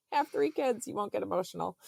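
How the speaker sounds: emotional, starting high and coming down; near tears, laughing it off. Real, not acted. Mother telling her birth story, laughing off tears. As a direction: joke through oncoming tears. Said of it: "gonna start crying again".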